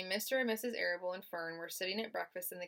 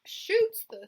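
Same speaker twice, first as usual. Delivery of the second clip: put-on old sheep voice; 9 semitones higher, 6 dB louder than usual.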